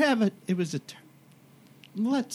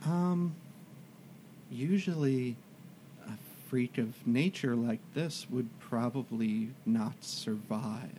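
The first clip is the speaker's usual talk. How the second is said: hesitant cleric; 7 semitones lower, 10 dB quieter than usual. Acted. Plays the cleric answering.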